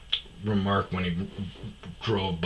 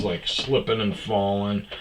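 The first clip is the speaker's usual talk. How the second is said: normal talking voice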